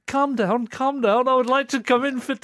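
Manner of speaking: high, loud mock Liverpool accent; bad Liverpool accent. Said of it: "I don't even know what a Liverpool accent sounds".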